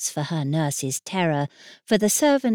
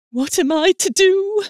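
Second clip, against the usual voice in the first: panicked; 13 semitones higher, 7 dB louder than usual. Acted. Panicked nurse.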